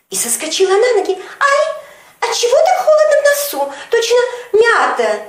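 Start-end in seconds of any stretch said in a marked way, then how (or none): 1.4-5.2 much higher, louder, squealing alarm; a girl shrieking at the cold sting in her nose; startled squeal. Acted. Sonechka after sniffing the powder, high startled squeal. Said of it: "ахнула, чихнула".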